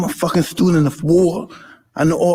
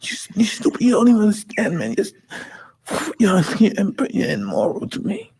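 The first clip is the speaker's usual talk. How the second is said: high-pitched, choked-up tearful praise; weeping while telling his hero what he means; crying; 4 semitones higher than usual. Real, not acted. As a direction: voice cracking as the tears take over. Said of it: "That's the reason why I'm crying".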